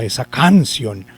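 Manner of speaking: "esa canción" with CAN punched, ción falling away; wrong stress on CAN. Shown as a demonstration. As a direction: say canción as CÁN-cion. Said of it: "si llevara el acento en la sílaba can". (esa canción, con el acento mal puesto en can)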